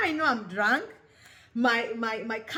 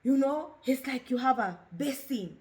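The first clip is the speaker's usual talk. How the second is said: ordinary talk, nothing marked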